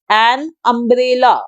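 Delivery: "an umbrella" said very slowly, each sound clear; teacher modelling "an umbrella"; slow example word. Shown as a demonstration. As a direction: stretch "an umbrella" for learners. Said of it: "यू की जो sound होती है".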